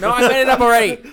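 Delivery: very high, loud, fast; laughing protest. Real, not acted. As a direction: squeal the denial through laughter. Protesting amid shared laughter.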